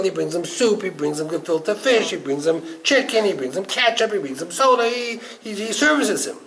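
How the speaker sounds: ordinary talk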